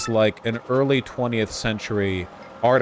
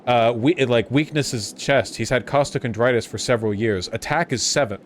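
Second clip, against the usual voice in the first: ordinary talk, nothing marked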